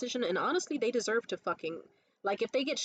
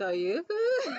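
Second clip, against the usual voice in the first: laughing quote; 7 semitones higher, 4 dB louder, slower than usual. Retold or imitated. Quoting woman, laughing.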